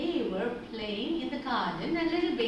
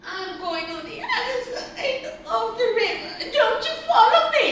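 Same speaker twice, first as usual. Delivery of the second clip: high, tearful wailing; crying spider; 10 semitones higher, 9 dB louder than usual. A narrator's reading. Spider leaves the alligator behind, crying. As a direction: sob out the words in a high, loud little voice. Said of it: "said the crying spider".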